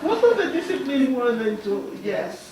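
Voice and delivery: amused, still laughing; chuckling answer. Real, not acted. Laughing with the questioner.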